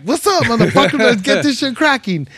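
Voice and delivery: high, loud crowd hype; hyped. Retold or imitated. Comic greeting the crowd onstage, high and loud. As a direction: fire up the room, high and loud. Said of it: "I'm like".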